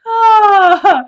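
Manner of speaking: loud, high "oh" after laughing; laughing sigh. Real, not acted. Recovering from a funny story about the daughter, loud laughing sigh. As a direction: let out a big winded sigh.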